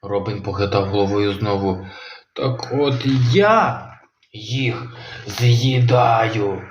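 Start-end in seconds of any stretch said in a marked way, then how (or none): 2.4-6.6 very slow, drawn-out growling threat; menacing monster. Acted. Kronosaur threatening Robin, very slow menacing voice. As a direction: savour the threat slowly.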